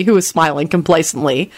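ordinary delivery, nothing marked

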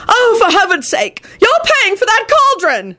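high mock shout of an angry old wizard.

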